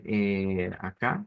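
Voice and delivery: usual speaking voice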